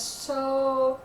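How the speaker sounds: ordinary talk, nothing marked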